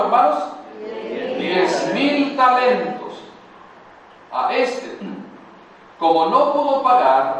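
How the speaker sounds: ordinary talk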